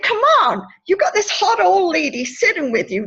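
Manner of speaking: high, loud, teasing insistence; playful exasperation. Retold or imitated. Woman joking to cheer up a boy, exasperated coaxing. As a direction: coax him with a high, punchy protest.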